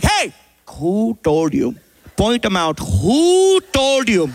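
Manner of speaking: stalk in like a falcon, demanding names; mom demanding. Retold or imitated. Mom swoops in on the kid in the sprinklers, menacing. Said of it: "She's like".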